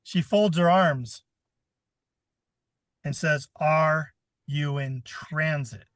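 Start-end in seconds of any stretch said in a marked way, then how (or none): 3.6-5.9 slow, drawn-out question, each word spaced; said slowly. Retold or imitated. Customs lady repeating herself to a confused American, slowly. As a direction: spell it out slowly to a dumb tourist. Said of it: "She said it slow".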